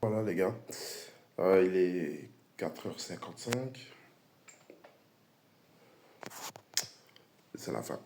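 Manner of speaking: hoarse voice after a night of shouting; lost voice. Real, not acted. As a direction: speak with a voice worn out. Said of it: "J'ai plus de voix".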